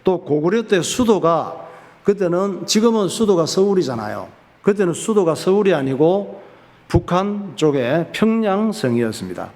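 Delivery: usual speaking voice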